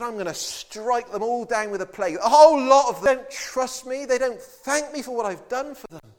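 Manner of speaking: God bursting out in anger; angry God. Retold or imitated. Preacher voicing God's anger at his people, loud and indignant.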